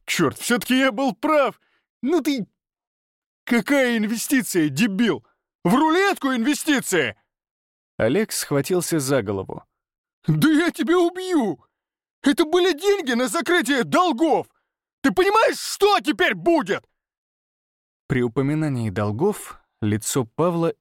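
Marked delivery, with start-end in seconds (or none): 0.1-7.2 very high, furious yell; mock his excuse with fury; raging. Oleg mocking Pavel's investment, raging yell. 10.3-16.8 very high, screaming outburst; furious threat. Acted. Oleg threatening Pavel over debts, screamed fury.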